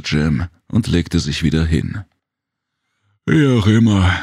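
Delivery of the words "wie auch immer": words stretched through a yawn, higher and louder. A narrator's reading. Sleepy Jem yawns at Serena while lying down. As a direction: let a yawn carry the words. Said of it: "gähnte der Oponi".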